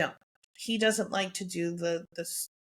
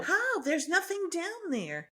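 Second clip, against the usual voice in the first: much higher, disbelieving; incredulous, pitch shooting way up; real incredulity; 10 semitones higher, slower than usual. Real, not acted. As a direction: react in high-pitched disbelief. Reacting to news of surviving fleas.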